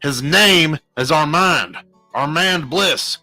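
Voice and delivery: slow, loud, emphatic correction; stern correction. A narrator's reading. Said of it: "he corrected sternly".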